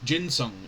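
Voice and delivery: "Ginseng" said "JIN-sung"; soft G. Shown as a demonstration. Reader corrects their mistake, "Ginseng" with soft G. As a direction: start "Ginseng" with the J sound of gin. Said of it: "It's ginseng".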